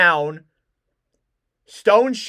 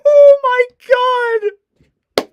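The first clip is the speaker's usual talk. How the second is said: laughing disbelief; 9 dB louder than usual. Real, not acted. Laughing after the goal.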